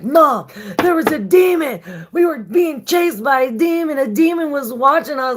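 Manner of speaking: high, loud, frightened telling; scared son. Retold or imitated. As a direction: blurt it out to mom in panic. Son reporting a demon, panicked.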